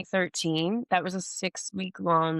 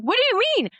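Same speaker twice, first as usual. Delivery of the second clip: high, louder startled squeak; 6 dB louder, faster than usual. Retold or imitated. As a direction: blurt it out way up high, caught off guard. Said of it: "I was so startled".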